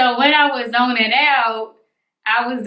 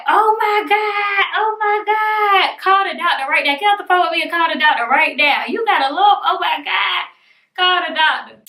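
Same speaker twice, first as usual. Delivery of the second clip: hammed-up motherly panic; dramatic mom; 7 semitones higher than usual. Mom hearing about the lump, imitated dramatic panic. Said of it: "who is gonna be dramatic with me".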